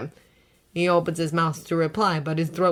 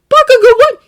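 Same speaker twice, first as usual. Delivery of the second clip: surprised; 17 dB louder than usual. A narrator's reading. Izuku startled.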